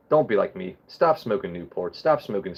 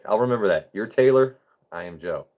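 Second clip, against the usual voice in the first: ordinary talk, nothing marked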